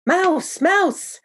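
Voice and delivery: high, loud panicked shriek; yelling. Acted. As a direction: scream it from the table in panic. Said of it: "started yelling at the top of her lungs".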